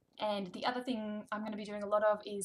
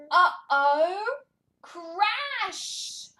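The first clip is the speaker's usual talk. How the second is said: loud, slow, exaggerated scolding; mock dismay; 4 semitones higher, 11 dB louder, much slower than usual. Shown as a demonstration. Reading to toddler, loud mock dismay. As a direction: ham up the shock.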